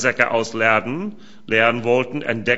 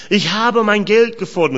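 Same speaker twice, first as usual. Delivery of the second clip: higher, louder cry of discovery; excited call; 8 semitones higher, 6 dB louder than usual. Acted. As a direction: call it out, startled and loud. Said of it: "rief er". (aufgeregter, lauter Ruf des Bruders)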